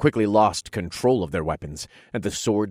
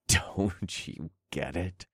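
mocking; 6 dB quieter, slower than usual. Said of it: "I chuckled with a wide grin".

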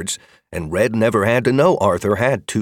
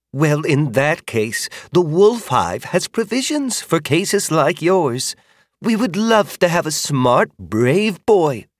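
higher, slow welcoming voice; kindly older woman; 8 semitones higher, slower than usual. Acted. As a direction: offer a home warmly. Magda offers the hive.

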